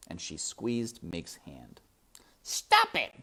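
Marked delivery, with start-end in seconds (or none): 2.4-3.1 very high, loud furious order; shouting. A narrator's reading. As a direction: shout to break it up. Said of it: "shouted Roscoe".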